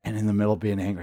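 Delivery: ordinary delivery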